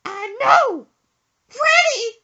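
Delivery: high, loud falsetto wail; mom's shriek. Retold or imitated. Son imitating mom learning her fish died, high loud shriek. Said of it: "Mama, one of your fish died".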